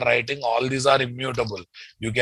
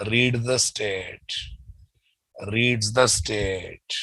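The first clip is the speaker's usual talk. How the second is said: slow prompting repetition; 4 dB quieter, slower than usual. Shown as a demonstration. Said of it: "repeated slowly, prompting class".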